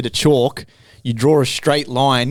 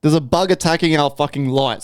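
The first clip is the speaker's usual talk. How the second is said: normal talking voice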